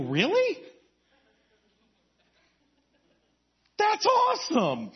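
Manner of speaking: delighted. Retold or imitated. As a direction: beam it out, really pleased. Delighted reply to former student.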